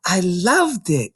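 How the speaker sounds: put-on a small girl answering the librarian; Matilda, young girl. Little Matilda explaining to Mrs Phelps, young girl voice.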